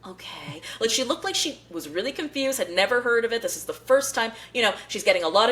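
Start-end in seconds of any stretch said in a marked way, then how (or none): 0.1-0.6 imitating a baffled, soft reply. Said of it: "And she's like".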